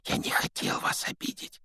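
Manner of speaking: soft unvoiced whisper. A narrator's reading. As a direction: whisper it urgently. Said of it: "прошептал Гарри".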